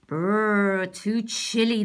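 a mild squeal of cold; a touch of a frightened squeak; squealing gingerbread man. Acted. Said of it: "the gingerbread man squealed".

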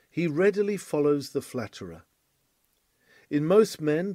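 usual speaking voice

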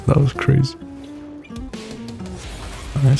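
ordinary talk, nothing marked

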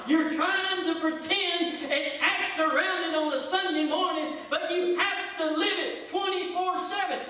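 rising shouted sermon cadence; preaching at full force. Acted. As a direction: preach it hard to the church.

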